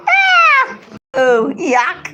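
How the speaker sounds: disgusted shriek then yuck; high shriek of disgust; shuddering revulsion. Real, not acted. Honey touches her arm.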